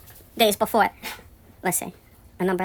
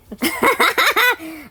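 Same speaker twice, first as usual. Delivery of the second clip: rueful laugh; 10 semitones higher, 3 dB louder than usual. Real, not acted. Laughing at the absurd rumour.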